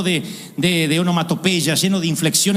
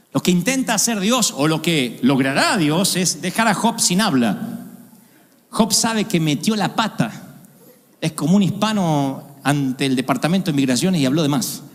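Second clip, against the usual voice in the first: ordinary delivery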